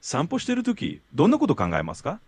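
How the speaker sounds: fast fluent Japanese question; native Japanese question. Shown as a demonstration. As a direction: clear native Japanese prompt. Tutor asks question in Japanese.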